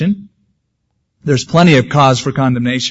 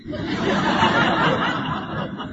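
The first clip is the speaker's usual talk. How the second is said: breathy laugh; 8 dB quieter than usual. Real, not acted. Joking about wife and mirror.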